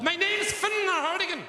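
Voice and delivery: high, slow, with a gulp inside "Fiona"; burp-like name. Acted. Woman giving her Irish name, burp-like middle. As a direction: stretch "Fiona Hardigan" with a hiccupping middle. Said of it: "Are you burping in the middle".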